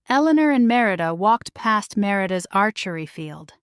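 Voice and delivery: usual speaking voice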